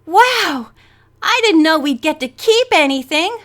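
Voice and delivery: wowed and thrilled; excited. A narrator's reading. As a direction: burst out with eager amazement. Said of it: "Bess said excitedly".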